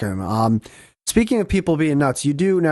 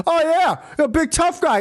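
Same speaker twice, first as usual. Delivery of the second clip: much higher, sneering mock of the old man; 12 semitones higher than usual. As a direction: taunt him from across the street. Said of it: "he goes".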